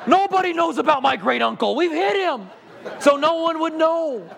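high, hammed-up confession; high, exaggerated amazed voice; mock secret-keeper. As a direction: play the gullible mark spilling secrets. Mock astonished audience member.

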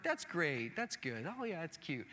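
mock a parent faking interest in kids' art; unimpressed parent praise. Parents glancing at their kids' art, dully polite. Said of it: "They're like".

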